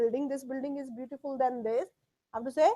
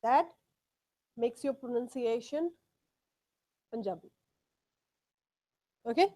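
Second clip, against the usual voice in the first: normal talking voice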